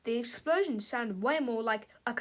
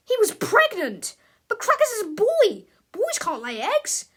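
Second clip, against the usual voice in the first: much higher and louder, a laugh breaking in; disbelieving shock; shocked outburst; 8 semitones higher, 9 dB louder than usual. Acted. George exclaims to Harold about the eggs, high and loud.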